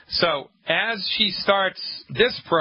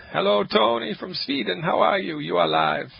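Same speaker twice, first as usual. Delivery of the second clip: raised-pitch, hammed-up fake Swedish accent; bad Swedish accent; 5 semitones higher than usual. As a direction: attempt a Swedish accent that lands nearer German. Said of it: "that was a really bad Swedish accent".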